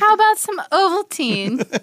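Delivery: high-pitched mock housekeeper; housekeeper offering drinks. Co-host mimicking the housekeeper offering Ovaltine, high and hammed-up. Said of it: "and she's like".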